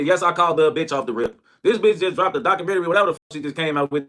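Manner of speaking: normal talking voice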